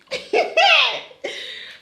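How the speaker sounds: giddy high-pitched giggle; a smitten squealing laugh. Real, not acted. Giggling over Shakib.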